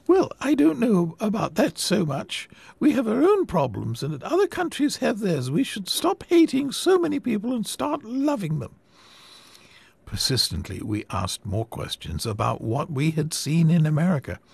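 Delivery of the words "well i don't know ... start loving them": put-on high, earnest old lady voice, fervent; a gentle old woman preaching her cause; sweet old lady. Old lady brushing off questions.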